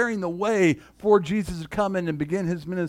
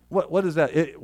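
ordinary talk, nothing marked

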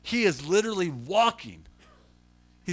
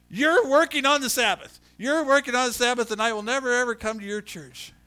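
raised, higher scolding voice easing off; imitated finger-wagging reproach; indignant accusation; 4 semitones higher, 4 dB louder than usual. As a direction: accuse like an offended churchgoer. She shakes her finger at me.

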